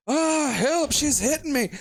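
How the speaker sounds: high-pitched Scottish cry for help; mock Scottish scream. Wasted Scottish boyfriend being beaten by his girlfriend, mock Scottish screaming. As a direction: wail it in thick Scottish, hammed-up. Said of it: "screaming in his, like, fucking thick Scottish accent".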